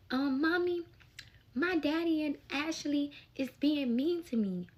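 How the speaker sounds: high-pitched imitated child; little daughter complaining. Daughter phoning Mommy about being mistreated, childlike complaint.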